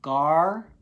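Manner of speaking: Gar-lick split in two beats; clapped syllables. Shown as a demonstration. Teacher clapping Gar-lick for spellers. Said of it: "you wanna clap it out".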